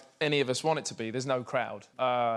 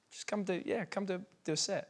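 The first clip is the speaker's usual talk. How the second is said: lightly voiced offhand invite, higher and softer; casual offer; 5 semitones higher, 6 dB quieter than usual. Friend casually inviting him to play, offhand and soft. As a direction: toss it off like it's nothing, soft and high. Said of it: "you said so casually".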